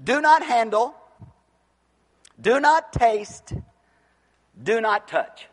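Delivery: mildly mock preacher, emphatic separate commands; preached slogan. False teachers preaching their slogan, mildly emphatic. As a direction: lay down each rule like a preacher. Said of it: "I think I've heard this preached".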